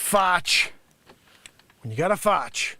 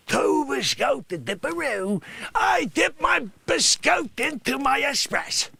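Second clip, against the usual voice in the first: character voice; 7 semitones higher than usual. Acted. Character bragging.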